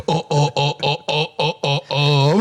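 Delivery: mock nervous stammer; nervous guy stuttering at a girl. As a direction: stammer like you lost your nerve. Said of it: "you stuttering".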